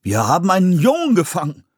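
surprised. Acted. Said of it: "stellte ein Mann überrascht fest".

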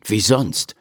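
ordinary delivery, nothing marked

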